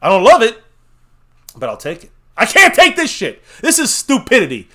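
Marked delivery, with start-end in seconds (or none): 2.4-4.7 high, loud exasperated outburst; frustrated rant. Real, not acted. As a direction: vent the fury at the pick. Fan ranting about draft pick.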